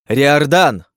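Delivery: loud high shout calling a name; whistling s. A narrator's reading. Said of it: "свистящий крик".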